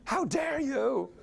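high, slow mock outrage of a little boy; angry toddler yelling. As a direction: scold the stove like an indignant toddler. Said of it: "yelled at it like".

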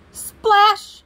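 high, loud "splash" burst; exuberant, high-pitched exclamation; exclaimed sound effect. A narrator's reading. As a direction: shout it like a big splash. Narrator voices the belly flop.

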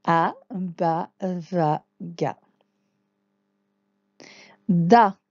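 Da called out loud and clear; teacher demo syllable. Shown as a demonstration. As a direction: model the syllable Da for the class. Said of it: "Je vous laisse lire".

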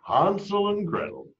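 plain reading voice

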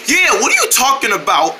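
ordinary talk

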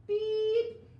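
very loud, high horn beep; truck horn beep. Pretend. Truck honks in story.

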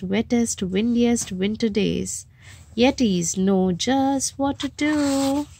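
plain reading voice

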